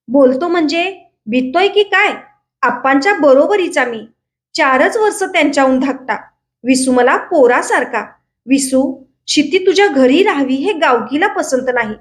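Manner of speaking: ordinary delivery, nothing marked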